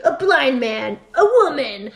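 item-by-item mock trailer announcement; movie trailer parody. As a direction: build the list like a comedy trailer. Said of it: "like, movie trailer".

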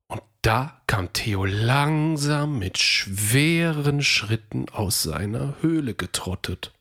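plain reading voice

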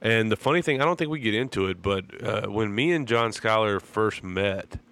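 normal talking voice

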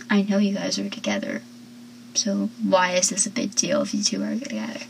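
soft, quick, knowing aside; what she really meant. Retold or imitated. Joey telling Dawson what she really meant, soft quick aside. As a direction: mutter it like the real subtext. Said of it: "But she really meant".

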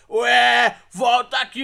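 loud taunting call; provoking call. Retold or imitated. Rival fans calling the group back, loud taunting call. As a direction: call them back to pick a fight. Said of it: "tipo assim".

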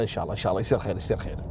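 low, soft reluctant mutter under the breath; mumbled yes to get out of the room; reluctant mumble. Retold or imitated. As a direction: grudgingly give in, barely audible. Lawyer reluctantly agrees to threat.

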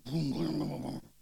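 rumbling motor splutter; imitated engine noise. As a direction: mimic an engine booming into life.

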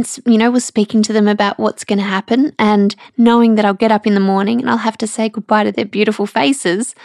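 ordinary talk, nothing marked

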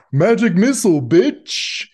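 high, loud taunting shout; full-voiced, high-pitched jeer; battle cry. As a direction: yell it like a kid casting a spell at someone. Kid hurls cigarette, casting a spell.

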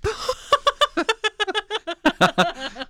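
loud high burst of laughter; laughing hard; big laugh. Real, not acted. Laughing at the melted pickle.

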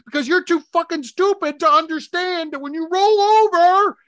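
loud, high exasperated shout; retold angry rant. Husband reenacting yelling at his wife over covers, loud and high. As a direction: blast her with mock fury. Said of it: "I'm like".

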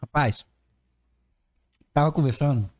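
talking with mouth full. Real, not acted. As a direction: speak through a mouthful of pizza. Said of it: "ó, falando de boca cheia".

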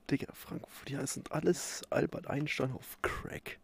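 hushed whisper to keep quiet; breathy whisper, no voice. Real, not acted. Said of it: "wieso flüsterst du die ganze Zeit".

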